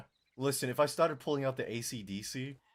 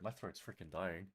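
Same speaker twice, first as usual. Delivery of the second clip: ordinary talk, nothing marked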